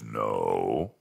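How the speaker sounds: a low, final no from the lion; lion refusing. Acted.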